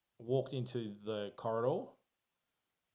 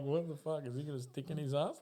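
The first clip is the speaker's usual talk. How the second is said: higher, laughing, incredulous voice; mock bewildered partygoers; 6 semitones higher than usual. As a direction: play the baffled onlookers with a laugh. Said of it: "they're like".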